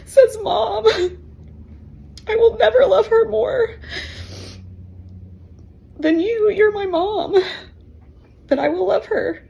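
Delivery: high, tearful voice breaking through the words; crying. Real, not acted. Mother weeping while retelling her daughter's promise, crying. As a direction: choke the words out through real tears.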